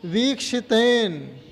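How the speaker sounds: वीक्षितेन slowly, with a held "vee"; long first vowel. Shown as a demonstration. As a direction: give time to the "vee" in वीक्षितेन. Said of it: "वीप को ज़्यादा समय दो".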